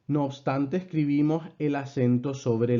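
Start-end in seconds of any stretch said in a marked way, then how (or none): none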